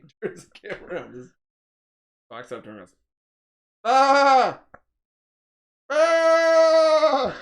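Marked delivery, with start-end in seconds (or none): 3.9-7.4 loud, high sheep "baa" imitation; sheep bleat. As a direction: blare like a sheep, high and drawn out. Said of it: "There's a sheep line directly".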